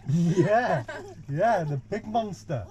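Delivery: loud laughing yeah. Real, not acted. Teasing child about mum.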